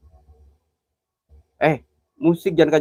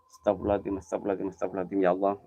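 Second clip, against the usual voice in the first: murmured prayer; 9 semitones lower, 11 dB quieter, much faster than usual. Real, not acted. Scared at a haunted well.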